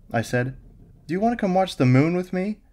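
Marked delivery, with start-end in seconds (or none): 1.1-2.6 put-on much higher, louder girlfriend's eager invite; light and eager, lift it up; voice rising. A narrator's reading. Girlfriend asks him to watch the moon, rising in volume. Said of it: "her voice raising in volume".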